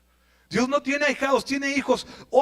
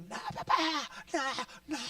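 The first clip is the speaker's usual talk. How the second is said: whispered pleading; 3 semitones higher, 10 dB quieter, faster than usual. Acted. Isaac imagined pleading.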